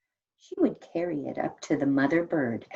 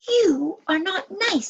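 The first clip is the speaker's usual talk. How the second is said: crossly; 13 semitones higher, 6 dB louder than usual. Acted. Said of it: "said the elf crossly".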